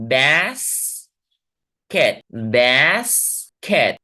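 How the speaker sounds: बैस and कैट drawn apart, vowels held long; slow teaching demo of बैस - कैट; syllable by syllable. Shown as a demonstration. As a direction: split it into बैस, pause, कैट with stretched vowels. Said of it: "मैं अब इसको पढ़ रहा हूं और आप गौर से सुनेंगे".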